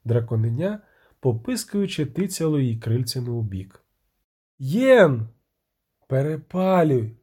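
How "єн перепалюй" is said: loud, drawn-out cries; anguished howl. A narrator's reading. Witcher and sorceress as the rope burns, drawn-out howl. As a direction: wail it out, stretching each word. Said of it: "завила".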